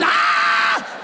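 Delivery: long mock scream; hammed-up cry of pain; pastor's shout. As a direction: yell a long "Ah" like a pastor being thrown. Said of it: "The pastor shout".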